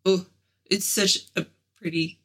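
ordinary talk, nothing marked

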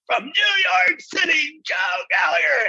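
high, drawn-out mock MC shout; announcer's shout. Announcer bringing the contestant on stage, high mock shout. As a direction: announce a star contestant to the crowd. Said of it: "they're like".

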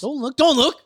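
high, loud playful warning; mock urgent warning. Joking playacting about peripheral vision.

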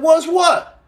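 high, innocent what's what; mock-innocent squeak of a question; playing dumb. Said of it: "And I said".

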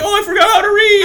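very high, loud mock voice of a clueless reader; mocking dumb co-host. Teasing co-host by mimicking him botching an ad read. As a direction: squeak it out like a dimwit who can't read. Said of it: "you're like".

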